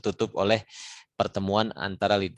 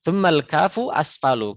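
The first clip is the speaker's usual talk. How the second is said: recited verse; 6 semitones higher, 5 dB louder than usual. Shown as a demonstration. Teacher reciting the tajwid verse to students. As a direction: chant the line clearly. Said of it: "Itu seperti itu untuk qaf".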